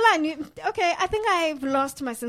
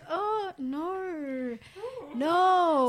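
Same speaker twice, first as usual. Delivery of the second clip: long drawn-out, whiny "oh no"; mock dismay; 3 semitones higher, 4 dB quieter, much slower than usual. Host acting out her reaction to monkey videos, mock dismay. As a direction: moan it as acted dismay, stretching each word.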